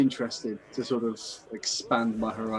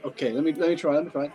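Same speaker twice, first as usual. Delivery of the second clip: usual speaking voice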